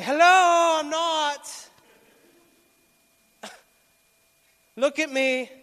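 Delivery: high, loud, sarcastic protest breaking into a laugh; exasperated retort. Retold or imitated. To people insisting he's healed, loud sarcastic disbelief. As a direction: throw it back at them in disbelief. Fed up with being told healed.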